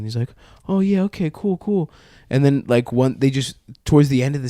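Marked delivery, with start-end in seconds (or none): 0.7-1.9 higher, softer eager agreement; gullible kid. Retold or imitated. Kid agreeing to join teammates, naively. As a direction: play along like a naive kid.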